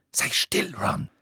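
high, irritated snap; cross, sharp rebuke; snapping. A narrator's reading. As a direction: snap at a friend to shut up. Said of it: "fauchte Hermine".

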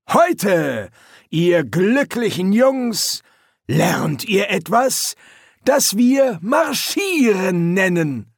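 high, loud, slow announcing shout; drill sergeant shout. A narrator's reading. Sergeant announcing marching practice, slow loud shout. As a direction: announce it to the recruits with relish.

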